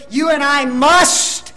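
"must" drawn out with rising emphasis; stress on must. Shown as a demonstration. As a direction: lean slowly and firmly on "must". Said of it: "I emphasize the word must".

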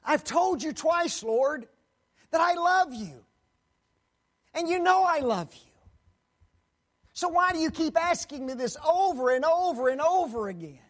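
loud, high, anguished plea; pleading Peter. Acted. Peter protesting to the Lord, loud and high. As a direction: cry out why he keeps asking.

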